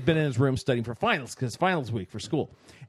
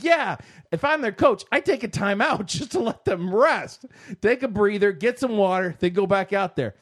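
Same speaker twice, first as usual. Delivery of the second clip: joking, laughing; 7 semitones higher, 3 dB louder than usual. Retold or imitated. Joking about the coach, laughing.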